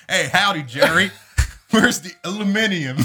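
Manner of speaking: high, loud hammed-up cowboy howdy; Texan yokel bit; mock Southern drawl. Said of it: "consistent with my accent".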